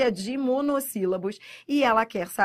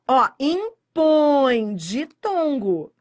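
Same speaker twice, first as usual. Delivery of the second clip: impõe and ditongo stretched slowly, louder; slow emphatic; 3 semitones higher, 6 dB louder, much slower than usual. Shown as a demonstration. Teacher stressing the key example, slow and loud. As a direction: lean on impõe, drawn out. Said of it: "Então, ó".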